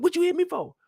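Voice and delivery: high whining question; whiny Doughboy. Retold or imitated. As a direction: whine it like a hurt kid. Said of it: "He said".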